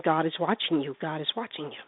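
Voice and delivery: low, soft unseen voice in a joke; mysterious voice. Acted. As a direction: drop low and quiet, like a hidden watcher. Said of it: "there's a voice, and it keeps saying".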